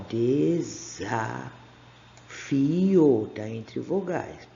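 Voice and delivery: "desafio" low and slow, stretched into syllables; "desafio" slowly sounded out. Shown as a demonstration. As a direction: draw out "desafio" to let the Z sound be heard. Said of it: "Segunda palavra aqui".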